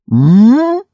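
startled distracted hum; a vague, roused "hm?"; absent-minded start. A narrator's reading. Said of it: "рассеянно встрепенулся Крашер". (рассеянное «м?»)

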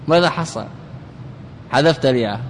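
ordinary talk, nothing marked

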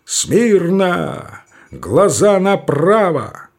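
slow, drawn-out parade command; barked command. Acted. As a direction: bark it like a drill officer. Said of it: "он командует".

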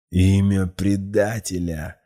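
slow, strained furious demand; through gritted teeth. A narrator's reading. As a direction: force it out between clenched teeth. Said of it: "процедил молодой человек".

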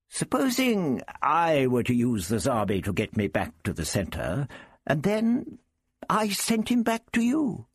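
put-on high, deliberate old gentleman; the Doctor, scheming. The Doctor proposes sharing the Zarbi with Prapillus, craftily. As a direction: float the plan, climbing high on the offer.

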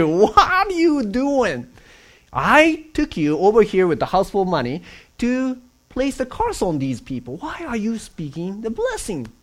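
high, loud indignant king; angry king. Retold or imitated. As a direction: scold the prophet. Angry king confronting Balaam.

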